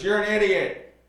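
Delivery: high, loud mock scolding; angry grandpa. Grandpa berates gullible grandson in the garage, angry yell. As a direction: yell it in comic exasperation.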